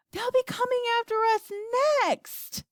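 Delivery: high, panicky yell; yelling grandma. A narrator's reading. Grandma fearing they are next, yelling. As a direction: yell in fear, pitch way up. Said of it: "yelled Grandma Georgina".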